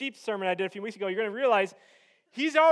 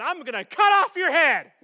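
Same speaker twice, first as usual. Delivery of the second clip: louder, higher shouted threat; defiant threat; 5 semitones higher, 8 dB louder than usual. Retold or imitated. Little runt David answering the giant, defiant shout. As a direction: shout it like a fighter's challenge.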